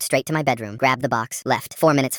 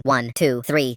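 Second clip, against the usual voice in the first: ordinary delivery, nothing marked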